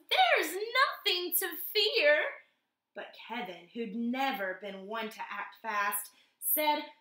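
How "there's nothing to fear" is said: slow, loud and higher encouragement; loud reassuring call. Acted. Dingoes coaxing Kevin down, slow loud call. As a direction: coax him down with a shout. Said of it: "called the Dingoes".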